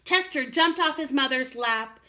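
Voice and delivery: usual speaking voice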